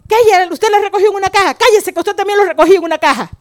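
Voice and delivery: put-on high, loud, quick child's protest; indignant little brother. Little brother confronts mother in defence of sister, loud indignant protest. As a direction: imitate a small boy loudly sticking up for a sibling. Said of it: "salí a preguntarle a mamá".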